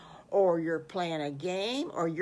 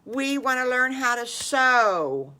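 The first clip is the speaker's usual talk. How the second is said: put-on higher, louder, slow eager kids; drawn-out, excited kids' answer; eager chorus; 6 semitones higher, 7 dB louder than usual. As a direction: call it out together like excited little pigs. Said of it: "all at the same time says".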